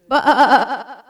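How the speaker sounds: high, loud calling bleat. A narrator's reading. Daisy calls to the shepherd, loud bleat. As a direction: call out again with all you have.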